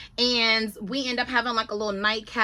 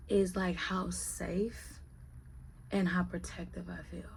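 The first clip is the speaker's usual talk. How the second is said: whisper; 4 semitones lower, 12 dB quieter than usual. Real, not acted. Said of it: "tender confession about Big".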